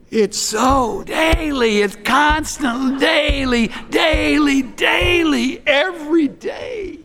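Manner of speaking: high, drawn-out, mock-exasperated insistence; exasperated repetition. Hammers the word daily, exasperated.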